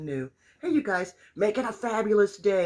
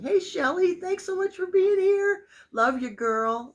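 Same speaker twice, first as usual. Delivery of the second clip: high, loud gushing hello; excited greeting; 12 semitones higher, 8 dB louder than usual. Real, not acted. Welcoming friends to her first Facebook Live, high and excited. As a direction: burst out welcoming the newcomers.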